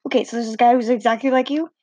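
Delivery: ordinary delivery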